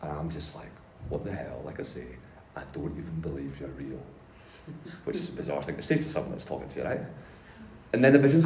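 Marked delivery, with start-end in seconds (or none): 1.1-1.6 low, very soft, deadpan bafflement; muted disbelief. Retold or imitated. Speaker answering a vision, quietly baffled. Repeats retold stunned reply. 2.6-4.0 low, very soft retort; quiet disbelief. Retold or imitated. Doubter talking back to Jesus, quietly disbelieving. Retold reply to the vision.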